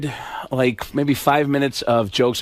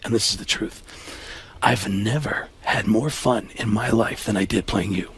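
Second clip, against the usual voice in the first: lower, hushed, sincere Baldwin; Alec Baldwin impression; 7 semitones lower, 4 dB quieter than usual. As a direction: confide it low and earnest. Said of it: "he said".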